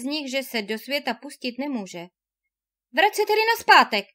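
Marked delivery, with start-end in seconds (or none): 2.9-4.0 high, quick and loud urging. A narrator's reading. Leonora urging her husband to turn back, loud and quick. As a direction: push him to go back, fast and bright. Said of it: "pobízela ho Leonora".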